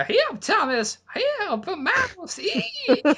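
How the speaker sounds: very high hammed-up squeal; mock damsel. Host mocking the damsel, very high hammed-up squeal. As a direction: shriek at a mouse, overdone. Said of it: "I was actually thinking more like".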